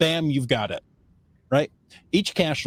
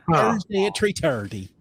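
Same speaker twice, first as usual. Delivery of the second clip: mimicked Irish, higher, "three thirty" as "tree tirty"; thick Irish accent; 4 semitones higher than usual. Vendor repeats the time on request, imitated Irish accent. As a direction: repeat it obligingly in put-on Irish, TH as T. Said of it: "thick Irish accent".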